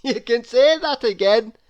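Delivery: amused agreement; Mister Happy. A narrator's reading. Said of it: "laughed Mister Happy".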